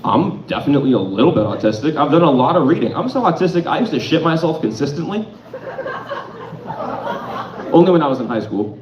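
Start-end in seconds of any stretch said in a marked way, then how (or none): none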